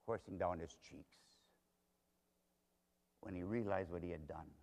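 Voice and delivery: very soft, low, grave narration; hushed sorrow. A narrator's reading. Preacher telling of Peter weeping bitterly, hushed sorrow.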